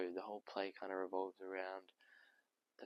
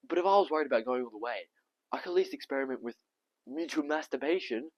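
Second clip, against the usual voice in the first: loud, fast, raised-pitch rush; mimicking flustered doctor; 6 semitones higher, 14 dB louder than usual. Tom imitating the doctor's sex advice, loud and rushed. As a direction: blurt the advice out in one rush.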